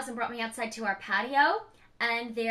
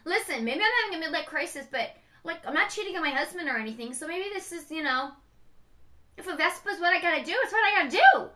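rising louder, high and fast defense; defiant justification; 5 semitones higher, 5 dB louder than usual. Retold or imitated. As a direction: talk yourself into it, building to the end. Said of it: "I'm like".

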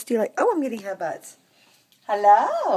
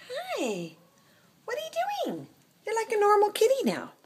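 put-on much higher, soft baby voice; squeaky pet talk; 13 semitones higher, 4 dB quieter than usual. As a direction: coo at the kitty in a tiny voice.

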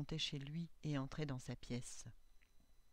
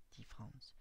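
usual speaking voice

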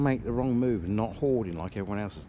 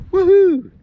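loud, high-pitched woohoo of triumph; celebratory whoop; 13 dB louder than usual. Real, not acted. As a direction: whoop out loud at a dream find. Celebrating a bucket-list find.

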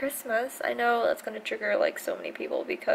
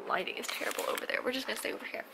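whispering; 12 dB quieter, much faster than usual. Real, not acted. Whispering aside while filming.

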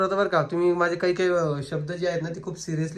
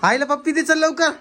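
coaxing; 10 semitones higher, 6 dB louder than usual. Real, not acted. Coaxing a pet for a kiss.

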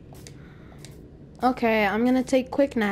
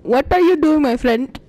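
put-on loud, higher-pitched Indian accent; 5 semitones higher, 8 dB louder than usual. Joking with a friend in a mock Indian accent. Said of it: "Like in an Indian accent".

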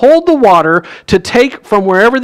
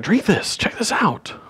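whispered tip-off; breathy hush, like sharing a secret; soft conspiratorial whisper; hushed aside; 9 dB quieter than usual. Retold or imitated. Servants hand wine to steward.

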